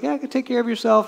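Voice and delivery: high, kind "take care of yourself"; caring goodbye; friendly send-off. Shown as a demonstration. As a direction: wish them well lightly. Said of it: "That's a nice thing to say".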